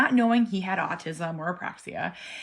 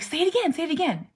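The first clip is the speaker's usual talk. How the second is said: high, louder encouraging prompt; eager coaxing; 10 semitones higher, 6 dB louder, faster than usual. Retold or imitated. Mom prompting her son to repeat a sound, high eager coaxing. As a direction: urge him on brightly.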